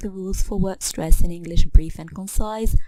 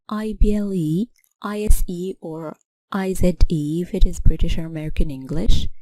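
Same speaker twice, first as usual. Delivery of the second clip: ordinary talk, nothing marked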